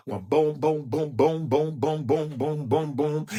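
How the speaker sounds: rhythmic boom chant. Pretend. Dancing sound effects.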